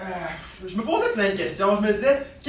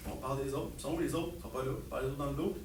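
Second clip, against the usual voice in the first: low, very soft admission; quietly owning up, voice kept down; confiding; 7 semitones lower, 14 dB quieter, much faster than usual. Acted. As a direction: drop down to a hushed, low aside. Father admits gossiping about others.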